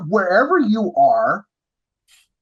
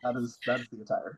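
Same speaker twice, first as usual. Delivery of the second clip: deadpan; 7 semitones lower, 13 dB quieter than usual. Retold or imitated. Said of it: "I'm like".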